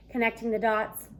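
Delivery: ordinary delivery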